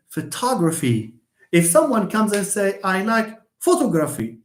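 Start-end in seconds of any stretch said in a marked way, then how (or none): 0.1-1.1 photography with the beat on TOG; stress on second syllable. Shown as a demonstration. Teacher gives the natural way to say it, second-syllable stress. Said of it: "That's why we say". 2.8-4.4 photography stressed up front, full O vowels, French-style; French-sounding mispronunciation. Shown as a demonstration. Teacher mimics a learner's error, French-like stress. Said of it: "you look like French".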